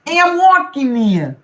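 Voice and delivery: put-on high, loud Brooklyn accent shout. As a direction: yell it like a New Yorker from Brooklyn. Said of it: "imagining ... Brooklyn accent".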